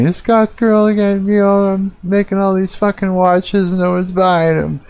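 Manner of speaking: high, whiny mock sob voice; mock crying. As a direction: parody a girl fake-crying over her watches. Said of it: "she's all crying".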